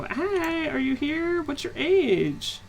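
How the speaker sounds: high, drawn out questions; mock ghost hunter. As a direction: ask it like a cheesy ghost hunter. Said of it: "walk around and go".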